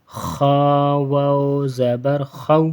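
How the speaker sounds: chanted "خا" with a full, heavy kh; thick kha. Shown as a demonstration. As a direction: hold "خا" long and make it thick. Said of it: "خا موٹا ہوتا ہے".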